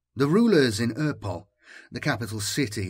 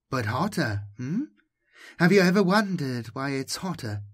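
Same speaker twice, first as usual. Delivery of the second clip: caressing voice; 4 semitones higher than usual. Acted. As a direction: coax him gently, almost cooing. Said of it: "Was the languid voice male".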